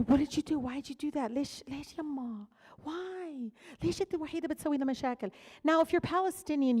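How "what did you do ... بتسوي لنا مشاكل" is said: higher, pleading scolding mother voice. Retold or imitated. Daughter imitating her mother scolding her, high and pleading. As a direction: whine it like an exasperated mom. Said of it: "she always says".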